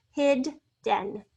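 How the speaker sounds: "Hidden" broken in two, hid, pause, den; "Hidden" slowly, a clear gap between syllables; split into syllables. Shown as a demonstration. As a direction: sound out "Hidden" with both Ds heard. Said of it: "We're doubling the D".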